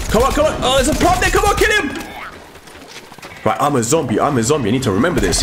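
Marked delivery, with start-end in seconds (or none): 0.1-1.9 high-pitched, excited urging; urgent egging on. Real, not acted. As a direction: egg them on in the heat of the fight. Urging on during fight.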